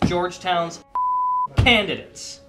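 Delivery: outraged emphasis. Acted. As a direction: bark both words in disbelief. Outraged repeat in rant.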